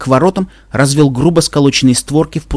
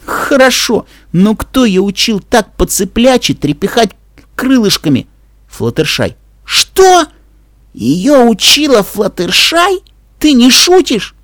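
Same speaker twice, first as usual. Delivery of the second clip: incredulous; 9 semitones higher, slower than usual. Acted. Dash stunned by flying lessons.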